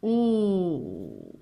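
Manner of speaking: "O" held long; stretched vowel. Shown as a demonstration. Teacher naming the letter while writing, "O" stretched. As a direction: draw out the letter "O". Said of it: "je vais écrire la lettre".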